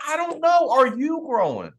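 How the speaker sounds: incredulous comeback, stretched out; challenging retort. Retold or imitated. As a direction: throw the question back, pitched right up. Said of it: "I'm like".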